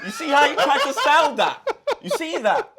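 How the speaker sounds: loud, laughing call-out; gleefully busting a friend's lie; excited laughing shout. Real, not acted.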